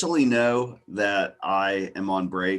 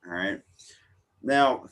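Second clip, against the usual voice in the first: ordinary talk, nothing marked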